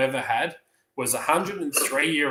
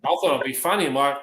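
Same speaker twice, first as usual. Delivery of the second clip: ordinary talk, nothing marked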